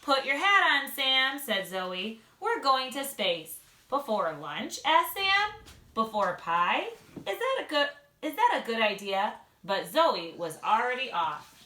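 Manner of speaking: lively read-aloud with character voices; bright storybook reading for little listeners; reading to children. Acted. Mom reads a picture book to second graders, lively and animated.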